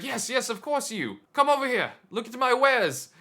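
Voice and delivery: loud, high market hawker; hammed-up vendor. Vendor calls visitor over to see his wares, hammed-up holler. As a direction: beckon eagerly in a big put-on merchant voice.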